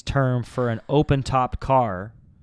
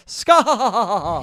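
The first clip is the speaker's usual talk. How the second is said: laughing; 9 semitones higher, 7 dB louder than usual. Real, not acted. Laughing repeat.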